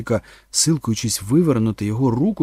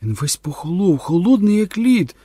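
raised, frightened mother's voice; alarmed outcry; 6 semitones higher, 6 dB louder than usual. A narrator's reading. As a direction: panic mounting, pitch climbing. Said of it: "вигукнула Кетрін". (стривожено вигукуючи)

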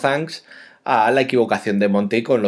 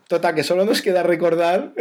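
laughing; 6 semitones higher than usual. Real, not acted. Still laughing after the blunder.

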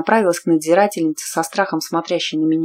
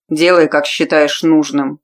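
gruff grumble; 6 dB louder, slower than usual. A narrator's reading. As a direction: grumble it grudgingly. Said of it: "буркнул".

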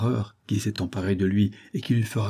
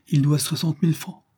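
s'écrie; 6 semitones higher than usual. A narrator's reading. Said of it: "s'écria Barthelas".

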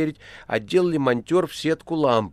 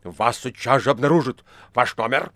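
loud, indignant threat; roaring; 7 dB louder than usual. A narrator's reading. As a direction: demand loudly and angrily. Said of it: "взревел Варенуха". (громко, гневно, с угрозой)

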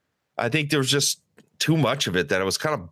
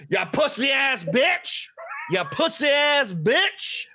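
imitated shout; 10 semitones higher than usual. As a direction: bellow it like the rude guy at the top of his lungs. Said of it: "at the top of his lungs".

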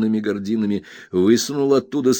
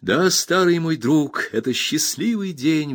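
put-on higher, heartfelt exclamation to an old friend; glad exclamation; 7 semitones higher than usual. A narrator's reading. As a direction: exclaim it gladly, pitched up. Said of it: "воскликнул я".

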